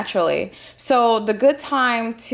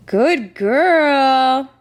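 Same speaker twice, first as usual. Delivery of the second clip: high, loud dog praise; talking to a dog; 4 semitones higher, 4 dB louder than usual. Real, not acted. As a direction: praise the pup with delight.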